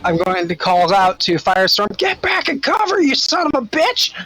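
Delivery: normal talking voice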